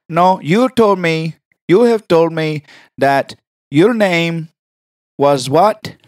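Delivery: slow, insistent put-on caller; prank caller voice. Correcting the receptionist, slow prank caller voice. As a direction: draw out each word as the prank caller. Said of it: "Why can you talk like, exactly like that".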